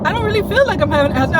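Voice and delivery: brushing off the doctor, fast and high; fast high denial. Retold or imitated. To her doctor, denying the asthma attack: fast high protest. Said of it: "and I was like".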